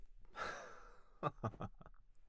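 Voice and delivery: a hushed, breathy chuckle; soft laugh. Acted. Butler chuckles after catching the lady's pillow, soft breathy laugh. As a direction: laugh under your breath, amused.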